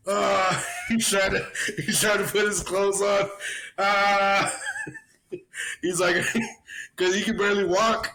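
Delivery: can't hold the laugh back; laughing as he tells of the wobbling sergeant. Real, not acted. Laughing while telling.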